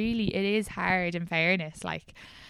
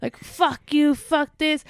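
higher, louder retold rant; drunk ex yelling; 8 semitones higher, 5 dB louder, much slower than usual. Ex swearing at her down the phone, mock yelling. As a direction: fire off the swearing like a furious drunk.

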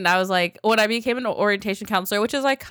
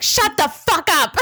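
high, loud, drawn-out mock yell; retold group yell; 9 semitones higher, 5 dB louder, slower than usual. Everyone snapping at the stung girl, mock furious yell. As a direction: yell it as the whole fed-up room.

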